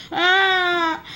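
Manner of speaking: high "ah" wail; high-pitched whiny scream; pretend scared wail. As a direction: cry out in mock fear. Frightened figure screams.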